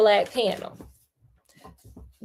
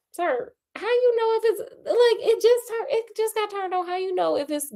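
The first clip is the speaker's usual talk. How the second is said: high squeaky voice; 11 semitones higher than usual. Acted. Sudden high-pitched mock questioning.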